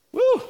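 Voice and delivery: a sudden high-pitched whoop; high woo. Real, not acted. Preacher whooping at Saul's jealous words, high whoop.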